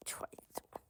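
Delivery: soft whispered coaxing; whispering. Real, not acted. Whispered offer to child.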